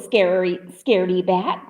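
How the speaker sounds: ordinary delivery, nothing marked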